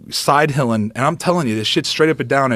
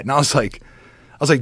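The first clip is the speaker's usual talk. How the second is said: ordinary talk, nothing marked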